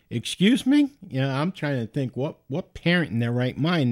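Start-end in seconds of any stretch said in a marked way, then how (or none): 0.1-0.9 high, loud, disbelieving question; mock-startled double take, pitched way up; incredulous retort. Reacting to the odd name.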